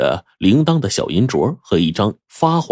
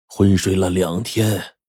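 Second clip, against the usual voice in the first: gentle; slower than usual. A narrator's reading. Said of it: "温和地说".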